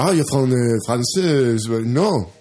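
English with a French accent; hesitant French-accented English. Shown as a demonstration. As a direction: put on a halting French accent, full of "eh". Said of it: "prata engelska med fransk brytning".

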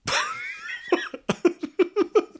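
real high laugh; bursting into laughter. Real, not acted. Laughing after his own outburst.

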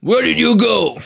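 high, loud mock bus driver. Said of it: "le mec me dit". (chauffeur irlandais imité, aigu et fort)

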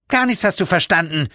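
put-on high, sharp, angry girl's hiss. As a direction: hiss it back at him. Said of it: "zischte sie mir böse zu". (böse zischend)

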